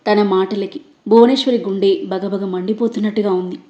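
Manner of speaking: ordinary delivery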